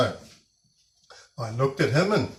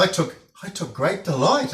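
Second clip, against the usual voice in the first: ordinary delivery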